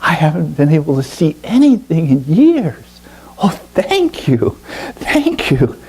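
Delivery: put-on high, wondering cries of joy from the blind boy; overjoyed blind boy. Blind boy thanks the bird for his sight, high and overjoyed. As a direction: let him gasp out his thanks, high and amazed.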